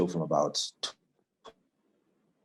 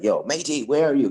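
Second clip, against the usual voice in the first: higher-pitched, lightly imitated Australian; Australian accent; 6 semitones higher, 6 dB louder than usual. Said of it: "He's Australian".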